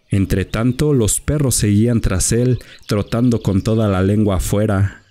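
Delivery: plain reading voice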